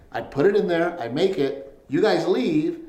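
normal talking voice

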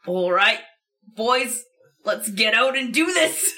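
put-on gravelly tough guy rallying the boys; gravelly man voice. Disguised player showing off a gravelly man voice. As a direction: fake a gruff, gravelly man's voice. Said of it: "Let's hear your gravelly man voice".

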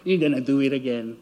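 low, taunting ha ha ha; mocking enemy laugh. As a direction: jeer like the devil gloating. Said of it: "the enemy will laugh at you".

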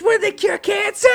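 very high, loud shout; shouted impression. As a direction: yell it in disbelief. Impersonated outburst.

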